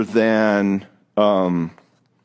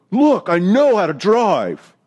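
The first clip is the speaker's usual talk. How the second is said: defensive snap; 5 semitones higher than usual. Retold or imitated. Husband to wife giving directions, slow affronted snap. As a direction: bristle at the wife's directions. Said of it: "And we go".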